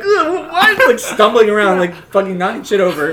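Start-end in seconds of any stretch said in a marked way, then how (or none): 0.0-0.9 high, loud laughing shriek; mock shock. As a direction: shriek in hammed-up confusion. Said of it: "I'm like".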